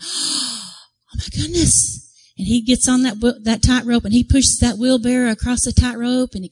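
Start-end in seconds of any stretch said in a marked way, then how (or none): 0.0-2.1 gasp, then slow high astonishment; drawn-out, awestruck and higher; astonished crowd. Retold or imitated. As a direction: imitate a stunned onlooker. Imitating crowd seeing wheelbarrow on rope.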